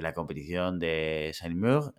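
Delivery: Saint-Mur said the French way, slowly; a French accent on Saint-Mur. Pretend. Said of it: "¿Habéis visto qué acento francés le he puesto".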